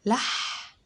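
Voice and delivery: لح breathed out voiceless like a snake's hiss. Shown as a demonstration. Teacher makes the ح sound like a snake's hiss for children. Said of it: "زي صوت الحية".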